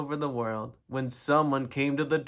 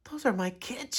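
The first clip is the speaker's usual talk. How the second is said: higher, scratchy-throated teacher; 8 semitones higher than usual. A narrator's reading. Teacher spotting her pupils outside, scratchy voice. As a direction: scratch it out in a raised pitch. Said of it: "she said in a scratchy voice".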